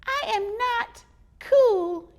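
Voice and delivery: high, slow, loud squeaky squirrel. Acted. Squirrel correcting the boy, slow and squeaky. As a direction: insist on it, squeaky and firm.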